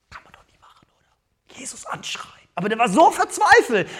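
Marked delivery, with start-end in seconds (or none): 0.1-2.3 breathy, hushed and scandalised; whispered aside to the congregation. Acted. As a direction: whisper it like a shocking secret. Pastor whispers the shocked aside.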